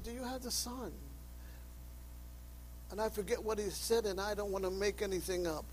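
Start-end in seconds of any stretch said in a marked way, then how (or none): 0.0-0.9 softer, probing question; quiet earnest question. Retold or imitated. Preacher retelling asking a man on the street, softly. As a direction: ask it gently, close in.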